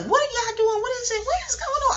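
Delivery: high-pitched imitation of a baffled old lady; mock old lady fussing at the youth; old school elder. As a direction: play a scandalised elder asking what the kids are doing. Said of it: "They'd be like".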